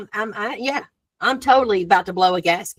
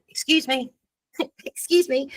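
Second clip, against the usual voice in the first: squeezing-past politeness; 5 semitones higher, 3 dB quieter than usual. Retold or imitated. Acts squeezing past shoppers.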